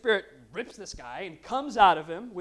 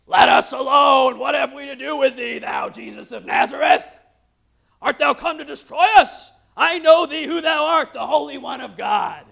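demon screaming; 2 semitones higher, 12 dB louder than usual. Acted. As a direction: roar it at him like a man possessed. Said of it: "he screams out saying".

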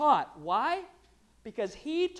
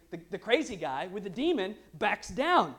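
ordinary talk

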